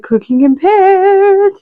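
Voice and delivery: high, slow, drawn-out title announcement; dramatic title call. Shown as a demonstration. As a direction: sing out the show's name like a jingle. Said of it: "That's right".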